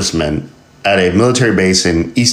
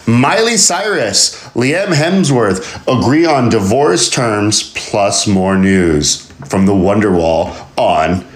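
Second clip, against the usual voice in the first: mock headline read; 3 semitones higher, slower than usual. Reading headline in put-on voice.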